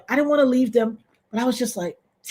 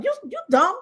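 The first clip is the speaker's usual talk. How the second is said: much higher sneering voice; mocking robber; 11 semitones higher than usual. Robber mocking her, higher sneering voice. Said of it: "he looked at me like".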